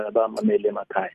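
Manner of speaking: ordinary delivery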